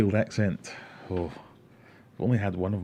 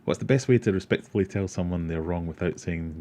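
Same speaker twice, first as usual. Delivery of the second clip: normal talking voice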